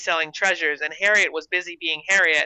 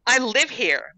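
higher, indignant retort; snapped; 6 semitones higher, slower than usual. A narrator's reading. Mandy answering Gertrude in her own yard, snapping back. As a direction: snap back with pitch raised. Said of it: "Mandy snapped".

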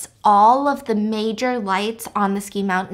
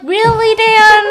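exasperated groan; 11 semitones higher, 9 dB louder, much slower than usual. Acted. Friends mock-groaning at Dan's question.

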